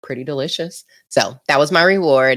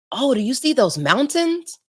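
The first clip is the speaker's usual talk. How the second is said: higher-pitched mock mom, wondering; imitating mom; 8 semitones higher than usual. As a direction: voice mom asking, lifted in pitch. Mom voiced in a funny story.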